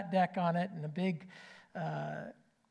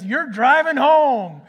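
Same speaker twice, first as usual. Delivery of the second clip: slow, loud, stern repeat; a father ordering his son to drive home; firm insistence; 5 semitones higher, 11 dB louder, slower than usual. Retold or imitated. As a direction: lay down the law, no arguing. Dad repeats firmly.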